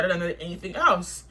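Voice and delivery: usual speaking voice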